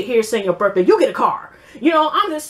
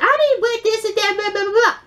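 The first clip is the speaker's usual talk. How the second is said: mock whiny quote; 9 semitones higher than usual. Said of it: "Shonda was saying".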